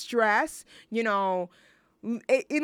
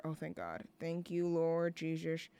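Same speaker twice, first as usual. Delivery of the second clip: relieved murmur; 5 semitones lower, 10 dB quieter than usual. Real, not acted. Relief after a scare.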